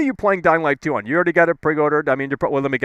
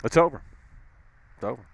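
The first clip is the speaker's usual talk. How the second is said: normal talking voice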